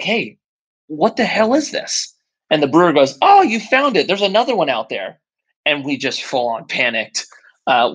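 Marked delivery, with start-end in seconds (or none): 0.0-2.1 imitated high-pitched, incredulous demand; alarmed confrontation. Partner confronts brewer over the rod, alarmed demand. 3.2-5.2 imitated high-pitched pleasant surprise; surprised discovery. Brewer to partner who found the rod, surprised. Brewer's acted reaction to the find.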